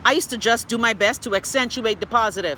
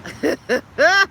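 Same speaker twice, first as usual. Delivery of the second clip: high burst of laughter; 7 semitones higher, 5 dB louder than usual. Real, not acted. Laughs at a viewer comment.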